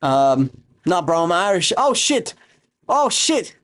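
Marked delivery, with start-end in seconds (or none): 1.8-3.6 high surprised yelp of realisation; surprised oh shit. Real, not acted. Streamer realising the viewer is Irish, high startled surprise. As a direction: caught off guard, voice jumping high.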